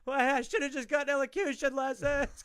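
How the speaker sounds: hammed-up cry of an uncle going under, regretting his choice; drowning uncle. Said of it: "he's just there like".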